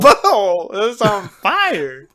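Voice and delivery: high, loud, drawn-out comic scream; mock agonized wail. Thanos crying out in pain, hammed-up agonized wail. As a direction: wail it slowly through laughter. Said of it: "He says".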